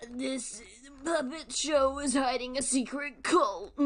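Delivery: loud sneering impression of a theory channel; mocking MatPat. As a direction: parody an overexcited theory youtuber. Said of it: "his stupid".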